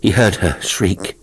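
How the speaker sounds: ordinary delivery, nothing marked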